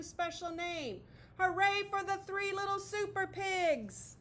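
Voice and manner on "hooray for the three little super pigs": high, loud cheer. A narrator's reading. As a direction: cheer it out in triumph. Story's triumphant cheer.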